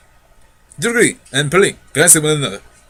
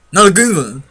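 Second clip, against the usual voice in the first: louder, sharp no; firm refusal; 3 semitones higher, 5 dB louder than usual. Acted. Uncle stops Polly taking a green ring, louder. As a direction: cut her off firmly.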